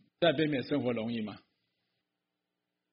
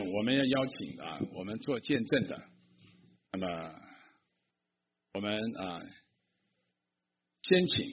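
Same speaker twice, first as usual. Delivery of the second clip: normal talking voice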